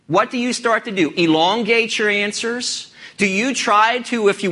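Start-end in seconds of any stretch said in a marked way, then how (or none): none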